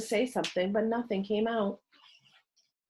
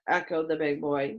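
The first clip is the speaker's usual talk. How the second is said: plain reading voice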